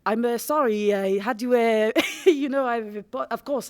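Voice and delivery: loud, hammed-up French accent. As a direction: stumble through an apology in a mock French accent. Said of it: "he has to do a French accent".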